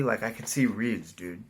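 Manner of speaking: ordinary delivery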